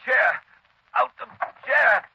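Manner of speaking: straining effort. Acted. Paralyzed man fights to rise, straining effort. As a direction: force each word out while straining.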